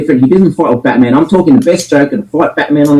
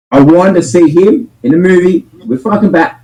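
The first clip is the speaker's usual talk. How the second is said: normal talking voice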